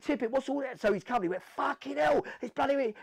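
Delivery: shocked officer. Retold or imitated. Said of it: "the male went".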